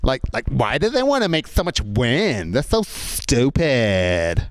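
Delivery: hammed-up American whine, very drawn-out ending; American tourist accent. American tourist blaming windmills, drawn-out mock American accent. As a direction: stretch it out like a dim American complainer. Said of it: "these American tourists talking".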